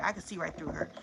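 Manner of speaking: ordinary delivery, nothing marked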